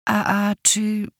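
slow, shaky, hesitant start. Acted. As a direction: stammer nervously, voice trembling. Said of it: "Głos Dagny trochę drży". (drżący, niepewny głos)

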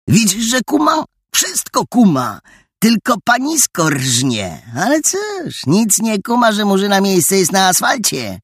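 wysoko, drwiąco, z rechotem w głosie; przedrzeźniaj z pogardą, podwórkowo; kpiący chłopak. A narrator's reading. Adaś drwi z dziewczyny. (drwiący, wysoki głos Adasia)

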